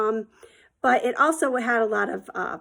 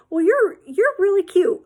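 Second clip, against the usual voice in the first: flirty stranger voice; 8 semitones higher, 5 dB louder than usual. Retold or imitated.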